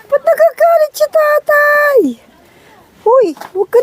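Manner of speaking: high playful coo. Real, not acted. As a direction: tease the angry father goat. Teasing the angry billy goat.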